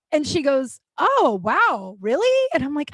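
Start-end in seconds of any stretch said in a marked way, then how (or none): 1.0-2.5 high, swooping mock surprise; nice but surprised. Bartender hearing she's sober, sweetly surprised. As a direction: imitate a kindly bartender's amazement, lilting way up. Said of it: "she's always so nice".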